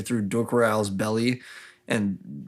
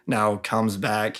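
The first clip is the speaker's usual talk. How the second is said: normal talking voice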